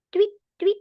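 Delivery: high, short playful chirps; bird tweet. Pretend. Teacher quizzing a child on animals, bird tweet. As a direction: peep like a little bird. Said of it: "animal is that?".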